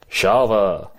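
mock muddled foreign accent; Charles Bronson. Retelling the guard's shower scene, mock foreign accent. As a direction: imitate an unplaceable, vaguely Eastern European accent. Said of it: "the accent he's doing is".

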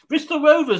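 high, loud teasing Bristol Rovers; teasing exclamation. Real, not acted. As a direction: rib him about his team with a big jump up. Teasing about his team.

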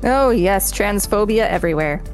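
normal talking voice

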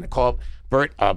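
ordinary delivery

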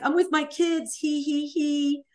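high, singsong mocking of a coy woman; mock coy giggle. Mimicking the other woman's texts about her kids, mock coy.

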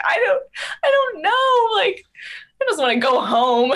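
very high, loud, tearful wail; mock sobbing. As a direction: wail like a tired child near tears. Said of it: "I was, like, crying".

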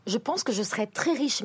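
usual speaking voice

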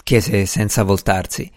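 ordinary delivery

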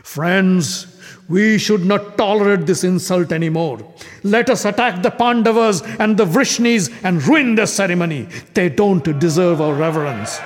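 loud, fiery call to attack; rabble-rousing king. Acted. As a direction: stir the kings into revolt. Shishupala incites the kings.

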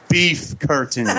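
slow, soft, stifled laugh. Real, not acted. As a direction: hold back a laugh. Announcing rude drinking word.

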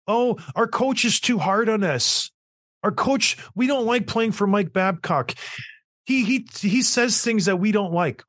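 hammed-up whiny player voice. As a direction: complain like a pampered kid. Mocking players' complaints.

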